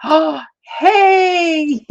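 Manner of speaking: sudden high gasp into hello; gasped excited greeting. Real, not acted. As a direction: catch your breath and greet them. Greeting another viewer.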